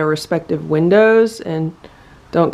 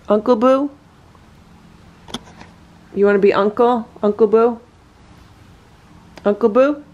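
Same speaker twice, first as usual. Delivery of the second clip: sing-song pet talk; 3 semitones higher than usual. Real, not acted. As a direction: sweet-talk the big cat into being gentle. Cooing at the cat.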